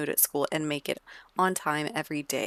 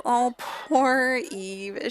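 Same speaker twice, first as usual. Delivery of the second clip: pitying; 7 semitones higher, 4 dB louder, much slower than usual. Real, not acted. Pities crying Sim after fire.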